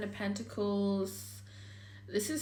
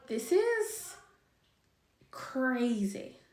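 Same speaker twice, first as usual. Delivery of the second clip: excited; 4 semitones higher, much slower than usual. Real, not acted. Reacts to cards.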